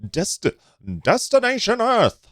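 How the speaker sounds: high, slow announcer voice, loud; old-timey radio voice. Pretend. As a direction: boom it like a vintage radio host. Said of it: "I had to do my old-timey radio voice".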